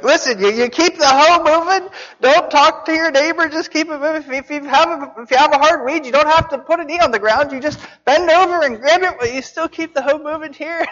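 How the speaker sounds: high-pitched mock scolding supervisor; nagging elders imitated. Grown-ups instructing a child hoeing poplar trees, imitated high-pitched nagging. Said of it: "but they would be like".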